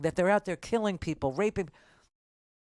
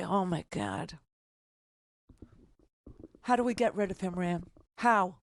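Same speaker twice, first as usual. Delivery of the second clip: hushed, lightly mocking worried plea; mock panicked caller; 3 semitones lower, 4 dB quieter than usual. Host imitates a caller's future panic, in a hushed mock plea. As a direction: softly pretend to be the frightened caller begging for help. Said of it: "and you'll say".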